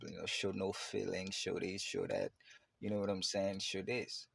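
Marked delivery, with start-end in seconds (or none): none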